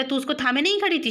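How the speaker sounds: fast indignant retort. Acted. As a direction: fire the blame back at sister quickly. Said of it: "Keshav बोलता".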